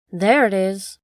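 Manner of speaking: a touch of exultation on seeing the light; excited cry. Acted. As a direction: shout out in triumph. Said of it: "I cried".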